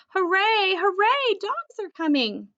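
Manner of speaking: high, loud excited cheer; thrilled cheer. Acted. Dog cheering as other dogs approach, thrilled. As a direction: celebrate the arriving dogs.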